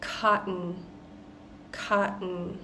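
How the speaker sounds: cotton with the T swallowed into a nasal n. Shown as a demonstration. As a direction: cut the T in cotton off in the throat. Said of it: "happens a lot with the double T".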